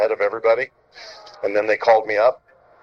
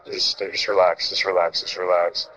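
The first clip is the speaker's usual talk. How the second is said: calming; 4 semitones lower than usual. Retold or imitated. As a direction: talk someone down gently, voice dropped low. Molitor calming the angry young pitcher.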